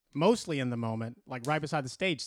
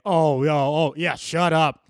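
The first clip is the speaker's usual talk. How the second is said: put-on louder, sloppy drunk comeback; drunk retort; 9 dB louder, slower than usual. Drunk crew member talking back, loud sloppy retort. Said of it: "Eric kept going back saying".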